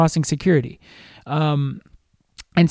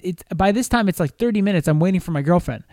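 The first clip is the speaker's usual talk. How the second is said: ordinary talk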